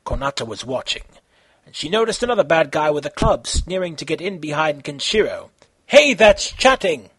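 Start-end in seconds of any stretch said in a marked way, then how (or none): 5.9-7.1 loud, slow call out; yelled warning. Acted. Konata warning about the sneaky bad guy, loud yell. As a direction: shout it at him. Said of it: "Konata yelled to the bad guy".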